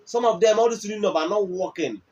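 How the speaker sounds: ordinary talk, nothing marked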